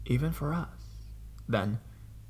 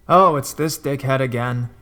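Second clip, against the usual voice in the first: loud annoyed yell of recognition; yelling coworker; 2 semitones higher, 8 dB louder than usual. Retold or imitated. Coworker calls out to the team, loud fed-up yell. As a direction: shout it across the room, fed up. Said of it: "yells out".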